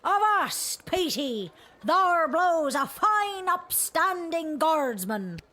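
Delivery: pirate voice. Acted. Pirate character hailing.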